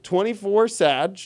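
normal talking voice

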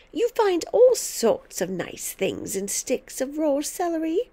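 ghoulishly delighted lecture; relishing grandmother. A narrator's reading. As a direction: gloat over horrid delicacies.

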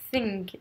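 "Think" with a soft voiceless th; tongue between teeth. Shown as a demonstration. Teacher showing French learners the English th, "Think" lisped on the tongue tip. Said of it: "vous mettez la langue entre les dents".

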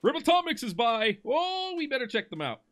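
high-pitched, excited acted quote; excited mock announcement. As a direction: act out the alert in a high, excited voice. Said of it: "and then it was like".

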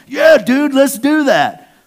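high, loud, gushing agreement; overeager yes. Retold or imitated. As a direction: friend jumping at the fake plan, gushing. Friend's overeager empty reply.

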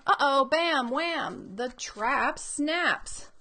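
dramatic story reading. Acted. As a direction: play up the sound words for young listeners. Dramatic climax of book read aloud.

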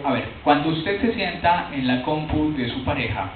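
ordinary talk, nothing marked

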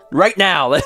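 high, laughing fake enthusiasm; mock eager excitement. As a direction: ham up an overeager streamer. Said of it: "do you think that makes me like".

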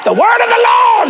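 very high, loud street-preacher yell; full-throated, high proclamation; preaching shout. Retold or imitated. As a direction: holler it to stop passers-by. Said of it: "So I just began to shout".

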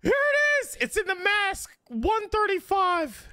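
excited. Real, not acted. Excited find of the mask.

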